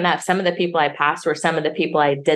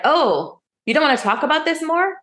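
much higher, quick voice of the worried caller; high concerned caller voice; 11 semitones higher than usual. Retold or imitated. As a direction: play her as surprised and concerned, pitched well up. Quoting caller on phone.

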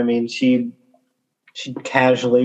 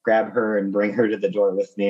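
ordinary talk, nothing marked

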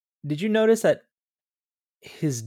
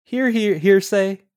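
high, loud call to a dog across the room; 10 semitones higher, 8 dB louder than usual. Retold or imitated. Man calling his dog Hearsay over, mock loud call. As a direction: sing out the dog's name to call him over. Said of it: "he calls the dog over and goes".

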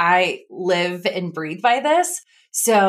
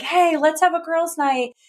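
much higher, chirpy invitation; mock bubbly invite; 11 semitones higher than usual. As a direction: pitch it like an eager text to friends. Retold text to girlfriends.

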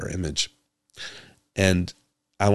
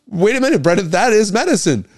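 high, loud mock protest; mock objecting listener; 14 semitones higher, 8 dB louder than usual. Host voicing a listener who objects, high and loud. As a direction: play the indignant listener.

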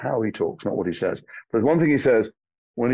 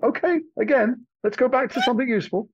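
high mock voice; 11 semitones higher than usual. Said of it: "I'm like".

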